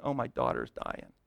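hushed, pleading plea of a desperate father; a touch of a father's desperate plea; quiet begging. Retold or imitated. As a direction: beg softly, voice dropping away. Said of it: "he's begging, saying".

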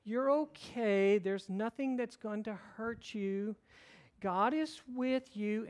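slow, singsong imitation of a child soothing a baby; sweet little girl. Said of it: "She's got this beautiful little voice".

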